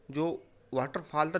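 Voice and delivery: usual speaking voice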